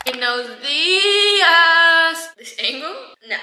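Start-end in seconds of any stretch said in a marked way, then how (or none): 0.1-2.2 very slow, sing-song good morning; drawn-out greeting. Real, not acted. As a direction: stretch it out lazily. Sleepy morning greeting, stretched.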